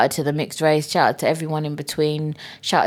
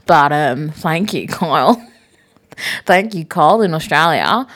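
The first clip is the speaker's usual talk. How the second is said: hammed-up fake Australian thanks; terrible Australian accent; 3 dB louder than usual. Host thanking listener in Australia, fake Australian accent. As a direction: exaggerate a poor Australian twang. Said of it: "that's a terrible Australian accent".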